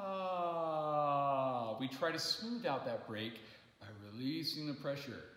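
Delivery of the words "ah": long sighing ah; yawn sigh. Shown as a demonstration. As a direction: sigh it out smoothly. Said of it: "as we yawn sigh".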